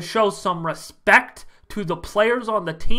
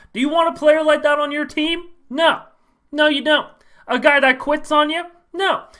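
pitched up, scornful questions; indignant high rant; 8 semitones higher than usual. Acted. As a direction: rant at the fans, high and outraged. Ranting fan character.

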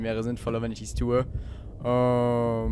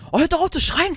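rattled; 4 dB louder than usual. Real, not acted. Screams in game.

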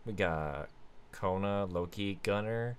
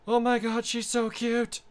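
high, loud squeal of delight; excited squeal; 11 semitones higher, 6 dB louder than usual. Real, not acted. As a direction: shriek Oh my god at a puppy, much higher and louder. Puppy appears on screen.

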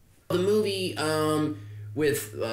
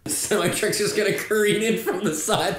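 ordinary talk

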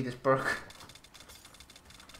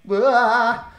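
sudden high scared yell; startled scream; 8 semitones higher, 8 dB louder than usual. Real, not acted. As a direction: cry out in fright. Scared by a zombie.

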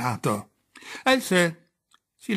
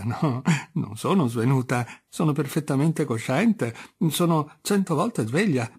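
usual speaking voice

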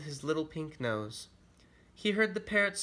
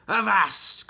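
loud, raised pirate shout; pirate cry; 6 semitones higher, 9 dB louder than usual. Acted. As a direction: bellow it like a pirate captain. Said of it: "cried Blackbeard".

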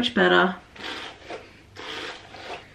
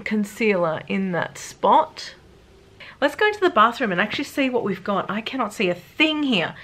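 usual speaking voice

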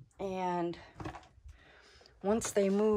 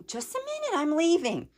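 much higher, louder placating voice; nervous coo; 11 semitones higher, 4 dB louder than usual. Real, not acted. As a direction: soothe the bird while retreating. Backing away from the vulture.

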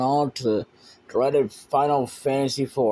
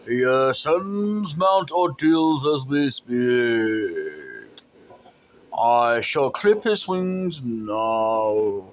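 slow menacing villain; slower than usual. Acted. Villain speaking slowly.

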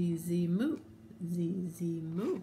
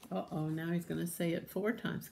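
ordinary delivery, nothing marked

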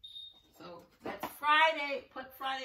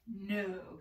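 ordinary talk

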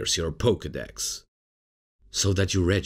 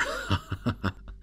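light, airy snicker; a short, breathy run of chuckles; derisive laugh; 4 semitones higher, 4 dB quieter than usual. Acted. Starter host mocking Bulbasaur to the trainer, derisive laugh.

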